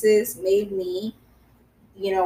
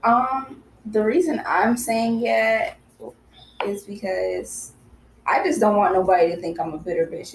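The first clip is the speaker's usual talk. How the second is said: ordinary talk, nothing marked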